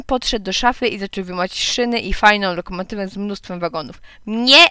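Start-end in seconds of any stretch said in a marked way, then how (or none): 4.3-4.7 loud, higher, emphatic refusal; shouting. A narrator's reading. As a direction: shout it like a child forbidding. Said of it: "krzyknęła Otylia". (głośny, stanowczy krzyk)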